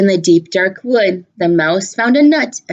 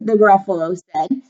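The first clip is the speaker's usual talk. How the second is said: plain reading voice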